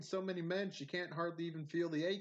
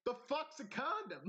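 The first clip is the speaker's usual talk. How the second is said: high-pitched baffled outburst, near laughing; mock bewilderment; 11 semitones higher than usual. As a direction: blurt it in exaggerated confusion. Retold clueless alien reply.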